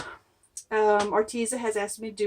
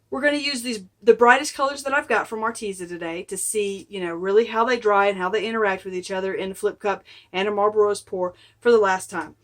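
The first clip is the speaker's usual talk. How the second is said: ordinary delivery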